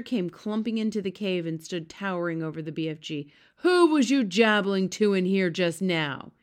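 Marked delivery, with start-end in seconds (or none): 3.6-6.3 loud booming giant's demand. A narrator's reading. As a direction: boom the question out. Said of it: "he boomed".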